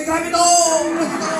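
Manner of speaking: high, frenzied repeated exclamation; hammed-up astonishment; amazed onlooker. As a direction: cry out as a stunned witness. Acting amazed onlooker in crowd.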